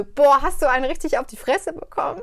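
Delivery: high, loud, startled outburst; imitating a lecturer's loud shocked question; shocked blurt.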